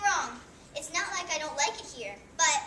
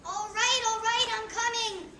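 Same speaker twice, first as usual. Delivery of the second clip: higher-pitched call to the door; exasperated calling out; 4 semitones higher, 3 dB louder than usual. Acted. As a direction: call out that you're on your way.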